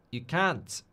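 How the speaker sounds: put-on South African accent. Streamer trying out a requested accent, South African "can't". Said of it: "South African accent".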